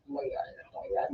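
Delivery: whispered, barely audible dismay; hushed mock shock. Teller aside to the other neighbor, hushed mock shock. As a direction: gasp it under your breath in a whisper.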